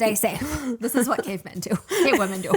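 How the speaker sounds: loud caveman voice. Retold or imitated. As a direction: boom it out like a caveman. Continuing caveman bit.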